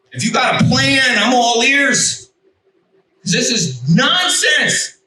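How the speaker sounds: high, slow exasperated plea; fed-up demand. Retold or imitated. Frustrated man demanding God's plan, slow exasperated high plea. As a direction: drag it out, sick of the nonsense.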